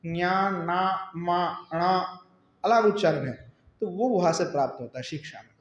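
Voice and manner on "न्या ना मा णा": न्या ना मा णा drawn out very slowly, each nasal kept distinct; slow nasal drill. Shown as a demonstration. Teacher drilling the pupils on the different nasal sounds. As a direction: stretch न्या, ना, मा, णा one by one, slowly and deliberately. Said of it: "तो यानी न्या".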